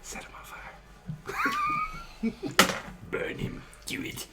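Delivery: whispered devilish urging; evil shoulder whisper. Acted. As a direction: hiss it like a tempter. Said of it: "the evil voice on your shoulder".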